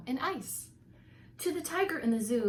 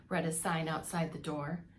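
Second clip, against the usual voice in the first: plain reading voice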